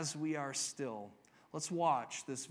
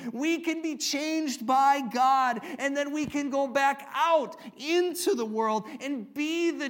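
raised, building sermon climax, slowing on key phrases; emphatic, high-pitched pulpit delivery; impassioned preaching; 7 semitones higher, 5 dB louder than usual. Real, not acted. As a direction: preach it with rising force, then drive it home.

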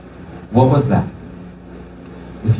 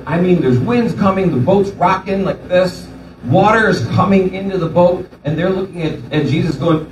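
usual speaking voice

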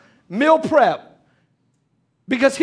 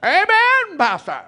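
mock congregation amen; 6 semitones higher, 3 dB louder than usual. Mocks congregation agreeing.

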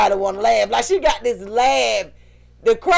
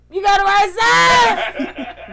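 slow, laughing near-shout; 9 semitones higher, 3 dB louder, much slower than usual. Retold or imitated. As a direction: crack up while drawing it out. Daughter's pants, retold laughing.

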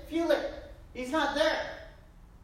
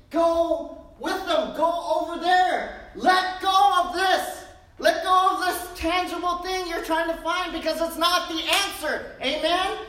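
higher and louder, urgent call to let go; fervent preaching; 6 semitones higher, 6 dB louder than usual. Acted. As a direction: preach it out to the congregation. Preacher builds to climax.

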